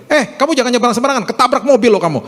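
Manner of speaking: fast, high-pitched threatening warning; acted parental scolding. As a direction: snap at a child as an alarmed parent. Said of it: "Seringkali kita ngomong gini sama anak kita".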